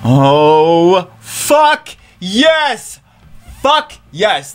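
high, ringing cheer; triumphant cheer. Real, not acted. Gamer celebrating the pull, triumphant cheer. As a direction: cheer it like a win.

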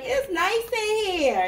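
drawn-out, high-pitched mock guest; slow singsong imitation of a delighted guest; imitated newcomer. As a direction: stretch it out high and slow, playing the visitor. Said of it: "And they're gonna be like".

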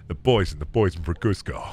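low put-on actor impression; Patrick Warburton. Streamer showing his easy impression, in a low put-on voice. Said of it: "I can do Patrick Warburton".

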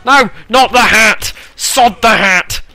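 high, loud cry of dismay; hammed-up panic; frantic protest. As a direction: shout as if losing something precious. Game character loses his hat.

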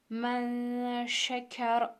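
very slow, drawn-out recitation of "men şeker" with a thick ra; heavy ra recitation. Shown as a demonstration. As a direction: chant "men şeker" very slowly, ra full and heavy. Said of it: "kalın okuyoruz". (çok yavaş, kalın ra ile tilavet)

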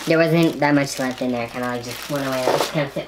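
very low, rumbling gas voice; deep gas voice. Real, not acted. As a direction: let the gas drag your voice way down. Deep voice after inhaling gas.

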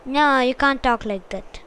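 ordinary talk

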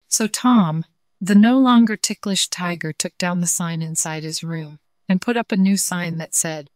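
usual speaking voice

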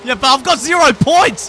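high, loud protest; exasperated shout. Real, not acted. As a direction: complain at full voice about losing. Losing badly in game.

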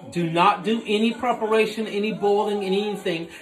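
normal talking voice

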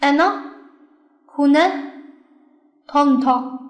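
Ana, huna, tonto with short final vowels; model reading, short endings. Shown as a demonstration. Teacher rereading examples to learners, final vowels short. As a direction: read Ana, huna, tonto as separate clipped-ending words. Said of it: "把这个例子给大家读一下".

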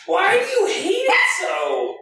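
very high, slow pet talk; high scolding pet voice; high dog-talk voice. Real, not acted.

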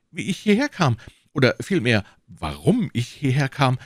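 high-pitched, flustered answer; flustered prisoner. A narrator's reading. Accused shopkeeper explaining himself to the commissioner, flustered and high.

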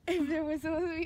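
words through laughter; laughing while talking. Real, not acted. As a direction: talk through a fit of giggles.

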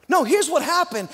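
ordinary delivery

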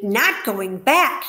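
a slow, loud cry, rising at the end; cried out. Acted. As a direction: Wilbur cries out in disbelief, drawing the words out. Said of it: "he cried".

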